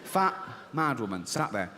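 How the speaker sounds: ordinary delivery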